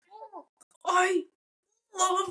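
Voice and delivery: forced I love you. Retold or imitated. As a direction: push the words out high and halting, half joking. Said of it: "now I'm saying this with the, a little bit fun".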